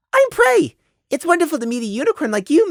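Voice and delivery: very high, fast, eager little voice; chirpy child. Acted. Prey meeting the unicorn mare, chirpy child voice. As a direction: introduce yourself like a delighted kid. Fake-innocent child introduces himself.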